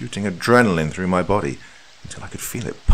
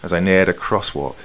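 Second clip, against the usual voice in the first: plain reading voice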